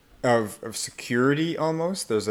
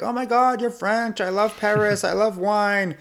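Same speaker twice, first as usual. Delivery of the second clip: high-pitched, over-excited fan of France; hammed-up squeal of delight; gushing new acquaintance; 11 semitones higher than usual. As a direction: gush as if thrilled to meet someone French. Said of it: "And they're like".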